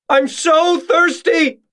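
very high, drawn-out wail; agonized scream. A narrator's reading. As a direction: wail it slowly in desperation. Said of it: "the screams getting louder".

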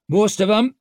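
high, loud call. A narrator's reading. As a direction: call the answer across the riders. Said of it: "called Jin".

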